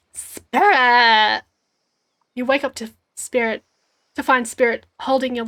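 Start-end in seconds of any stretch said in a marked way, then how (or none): 0.1-1.4 loud call of a name. Acted. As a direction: cry out the name. Calling out on waking.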